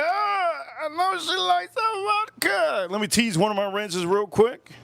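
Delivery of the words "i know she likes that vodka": high, slow falsetto fake crying; mock wailing. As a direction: whine it out like a hammed-up sob. Mock wailing repeat of line.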